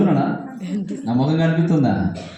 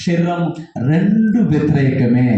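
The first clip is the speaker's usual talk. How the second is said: fiery preaching; 8 dB louder than usual. Acted. Preacher rousing the congregation about being used, fiery preaching. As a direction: preach it out to the back rows.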